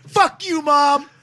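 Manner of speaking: high, loud mock defiance; hammed-up defiant son; mock defiant yell. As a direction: yell it back like a careless teen. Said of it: "And she's like".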